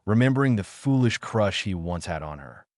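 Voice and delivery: ordinary delivery, nothing marked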